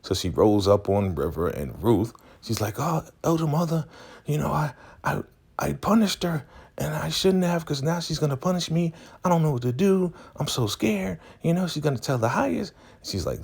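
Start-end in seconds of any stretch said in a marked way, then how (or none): 2.8-12.7 high-pitched imitated worry; mock scared. Zane afraid of punishment, confessing in high mock fright. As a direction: play a frightened young woman confessing. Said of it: "She is scared 'cause she knows".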